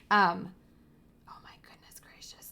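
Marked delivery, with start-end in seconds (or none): 1.3-2.5 hushed whisper over kittens; soft awed whisper. Real, not acted. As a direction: breathe it, barely audible.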